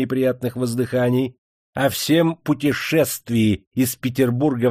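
rising fury; angry accusation. A narrator's reading. Interrogator accuses the prisoner.

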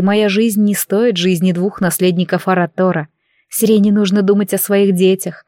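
plain reading voice

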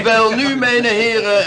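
loud call to order over the room. Acted. Official calling the assembled gentlemen to order, loudly. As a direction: raise your voice to get the gentlemen's attention.

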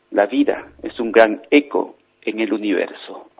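soft, measured closing moral; quiet, heartfelt storyteller's ending; wise, tender father. Acted. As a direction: give the lesson gently, with spaced pauses. Father's moral closes the tale.

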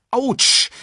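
high, loud yelp of pain; hurt. A narrator's reading. Simba with an aching back after the fall, yelping. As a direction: yelp as if you landed on something hard. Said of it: "Sein Rücken schmerzte".

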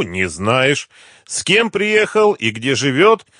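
high, loud sarcastic summing-up; mocking interrogation. A narrator's reading. Gangster mocking the captive's forgetfulness, sarcastic.

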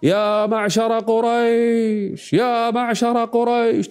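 high, slow, drawn-out public call; a herald's long high cry to the crowd; crier's call. Retold or imitated. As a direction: stretch each word out like a town crier. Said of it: "فأخذ يصرخ جميل".

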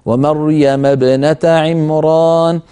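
slow chanted ومريم ابنة عمران; slow tajwid chant; Quran recitation. Shown as a demonstration. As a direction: intone the verse slowly. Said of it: "من أجل همزة الوصل".

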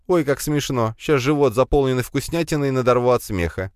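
high, mocking spider girl; sarcastic. A narrator's reading. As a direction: drip with sarcasm. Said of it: "добавила в мысленный голос немало сарказма".

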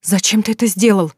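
ordinary delivery, nothing marked

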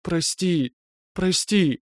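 high, breathless sobbing plea; sobbing apology. Acted. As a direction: gasp out the apology through tears. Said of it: "задыхаясь и всхлипывая".

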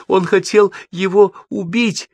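high, slow, insistent cry; distressed exclamation. A narrator's reading. Девочка говорит матери о кошмаре, distressed exclamation. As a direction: insist on it in high-pitched alarm. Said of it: "воскликнула девочка".

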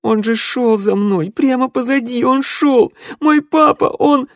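very high, loud wail; distraught cry. A narrator's reading. Daughter telling of her lost father, a distraught wail. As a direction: voice climbing in desperate grief.

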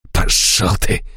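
hissed, spat out with contempt; spat curse. A narrator's reading. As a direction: spit the words at him. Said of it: "плюнул в меня Штольц".

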